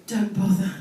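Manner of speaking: normal talking voice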